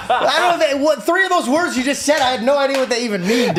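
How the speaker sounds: loud, high, laughing disbelief; laughing while talking. Real, not acted. Guy admitting he didn't know the words, laughing loudly. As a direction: laugh through the confession.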